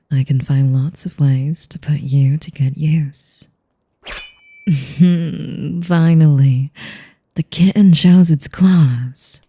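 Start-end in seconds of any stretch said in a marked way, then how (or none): 4.7-5.8 amused laugh. Acted. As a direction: laugh at the kitten's claws. Vampire amused by drawn knife.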